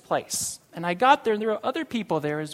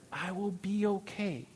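much softer, gentle reassurance to himself; soft echo; 6 dB quieter than usual. Acted. Pastor quietly repeating the promise for the congregation, soft. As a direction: let it fall quietly, like settling into the promise.

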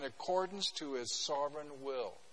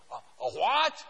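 louder, stammering disbelief; startled Noah; 7 dB louder, slower than usual. Retold or imitated. Pastor voicing Noah stunned by God's order, louder and flustered. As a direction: stumble over it in shock. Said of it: "I can imagine Noah".

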